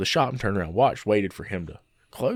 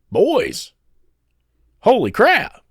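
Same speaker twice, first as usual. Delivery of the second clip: excited realization; 9 semitones higher, 6 dB louder, much slower than usual. Retold or imitated. Realizing the profit math.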